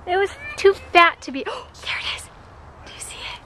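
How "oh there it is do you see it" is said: hushed whisper, barely voiced. Real, not acted. Mother whispering to her kids while spotting wild animals. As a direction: whisper so the animals don't bolt.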